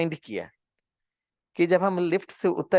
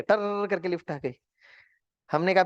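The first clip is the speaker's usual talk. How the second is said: usual speaking voice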